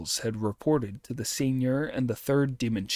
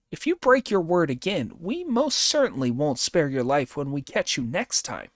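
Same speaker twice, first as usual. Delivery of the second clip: Monkey voice; 5 semitones higher than usual. Acted. Monkey spares the demon.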